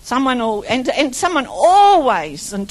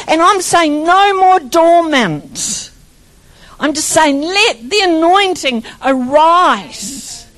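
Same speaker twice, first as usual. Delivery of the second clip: preaching; 3 semitones higher, 4 dB louder, slower than usual. Acted. Preaching crescendo.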